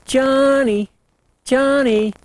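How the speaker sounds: high, sliding cow calling her calf; calling cow elk. Retold or imitated. Cow calling her lost calf, high and sliding. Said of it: "the cow to the calf saying".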